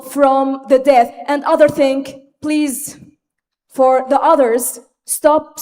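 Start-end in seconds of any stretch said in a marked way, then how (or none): none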